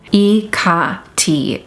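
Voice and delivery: "E K T" as separate syllables, ee-KAH-tee; closest original pronunciation. Shown as a demonstration. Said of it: "is the closest that we can get to".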